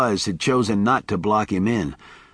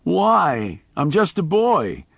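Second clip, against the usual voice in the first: put-on high, plaintive child's plea; pleading boy; 7 semitones higher, slower than usual. A narrator's reading. Boy Miles pleading with Charlie, high and plaintive.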